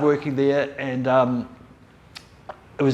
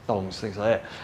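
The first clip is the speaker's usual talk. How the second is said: ordinary delivery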